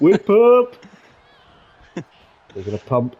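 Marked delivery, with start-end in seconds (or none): 0.0-0.8 high loud gleeful cry; gleeful shout. Real, not acted. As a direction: belt it out with joy. Name shouted in glee.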